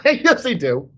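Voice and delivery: laughing insistence. Real, not acted. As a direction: insist through a burst of laughter.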